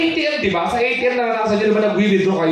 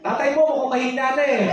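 higher, teasing gossip voice; mock gossip; 9 semitones higher than usual. Guest at a wake teasing about the father in a high sly voice. Guessing who is in the coffin.

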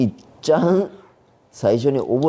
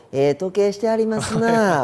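snide Kyoto dialect; 9 semitones higher than usual. Acted. Kyoto local needling someone with money, snidely admiring. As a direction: coo a barbed Kyoto compliment high up. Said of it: "嫌味、その嫌味っぽいの".

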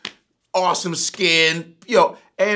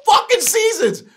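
much higher, loud shout of disbelief; outraged shout; 7 dB louder than usual. Real, not acted. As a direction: yell it in real indignation. Outraged at two seasons only.

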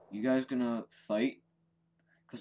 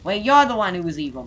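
excited accusation; 5 semitones higher, 11 dB louder, faster than usual. Acted. Accusing Mario loudly.

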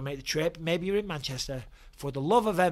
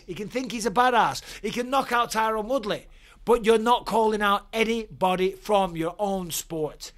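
angry rant; 5 semitones higher, 6 dB louder than usual. Real, not acted. Angry rant at Jake Paul.

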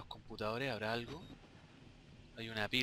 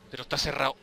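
panicked shouting, higher and louder; being chased; 4 semitones higher, 7 dB louder than usual. Real, not acted. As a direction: yell in panic to get out. Trapped, monster chasing.

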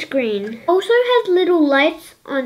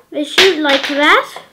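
ordinary talk

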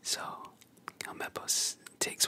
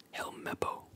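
ordinary delivery, nothing marked

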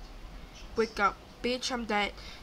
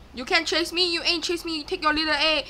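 higher, louder playground taunt; excited taunting; 5 semitones higher, 6 dB louder than usual. Real, not acted. Player to killer chasing her, excited taunting. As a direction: taunt the chaser at a raised pitch.

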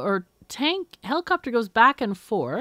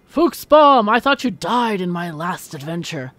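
loud, high villain face-off; dramatic adventure characters; 8 semitones higher, 10 dB louder than usual. Acted. Treasure hunter confronting an old enemy, hammed-up drama. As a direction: play it big like an action-movie showdown. Reads game character lines aloud.

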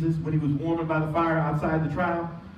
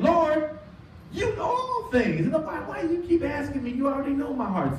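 high, pleading, exasperated Peter; high-pitched frustrated plea; 9 semitones higher than usual. Acted. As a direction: push it up high like a man tired of being asked.

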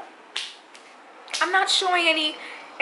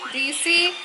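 usual speaking voice

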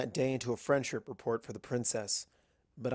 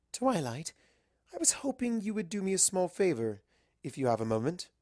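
high, refined and a bit on edge; Rarity asking a favor; 7 semitones higher than usual. Acted. Rarity asking Twilight a favor, high and refined. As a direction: ask politely while hiding nerves. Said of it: "she asked".

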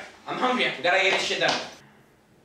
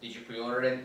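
low, lifeless clerk voice; numb, bored shop clerk; dead voice; 12 semitones lower, 9 dB quieter than usual. Acted. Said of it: "my dead voice".

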